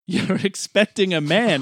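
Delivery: a sly Scottish woman; Scottish accent. Acted. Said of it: "Why is she Scottish?".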